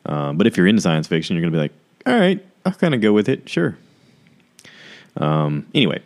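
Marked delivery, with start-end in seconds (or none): 2.0-3.8 high-pitched mock acceptance; acted listener reply. Sci-fi fan giving the show a chance, high mock voice. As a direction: voice the listener in a much higher pitch. Imagined listener going along reluctantly.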